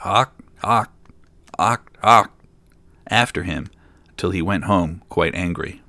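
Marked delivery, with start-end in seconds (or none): 0.0-2.3 loud, short frog croaks; punchy frog cries with gaps between; croaking frogs. A narrator's reading. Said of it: "But the frogs still cried".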